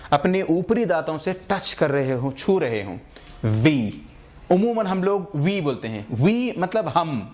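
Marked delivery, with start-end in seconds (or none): 3.4-3.9 وی said with lower lip on upper teeth, "vee"; lip-teeth demo. Shown as a demonstration. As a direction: buzz وی through lip and teeth. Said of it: "جب آپ وی بولیں".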